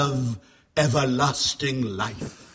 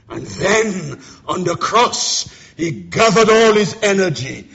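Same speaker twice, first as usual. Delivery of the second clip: loud, swelling pulpit preaching; preaching at full force; 4 semitones higher, 6 dB louder than usual. Acted. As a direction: build the sermon to a roaring climax.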